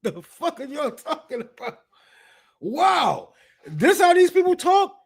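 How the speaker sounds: very high, stunned; shocked at how they talk; incredulous. Real, not acted. Reacting to the quote.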